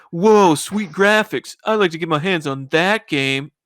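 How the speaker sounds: slow, drawn-out mock cool dude; cool kid reacting to the nerd's newsletter. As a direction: stretch out the whoa like a too-cool kid. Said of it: "the cool kid looks at the newsletter and goes".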